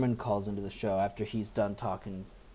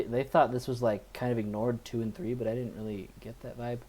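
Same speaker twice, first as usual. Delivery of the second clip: ordinary delivery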